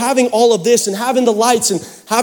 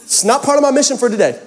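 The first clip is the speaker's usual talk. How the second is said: mock panic; 5 semitones higher, faster than usual. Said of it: "I'm like".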